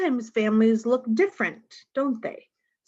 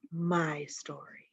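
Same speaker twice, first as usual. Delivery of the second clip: soft whisper; 4 semitones lower, 9 dB quieter, slower than usual. A narrator's reading. Child murmuring about her favorite bedtime story, whispered. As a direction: trail off into a breathless whisper.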